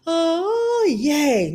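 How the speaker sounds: half-hearted little cheer; mock yay. Sharing the shine of a lesson, mock cheer. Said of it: "the wisdom as the".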